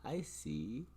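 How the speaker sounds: soft breathy whisper aside. Real, not acted. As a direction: whisper it to yourself. Quiet aside after laughing.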